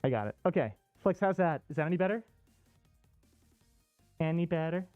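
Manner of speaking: normal talking voice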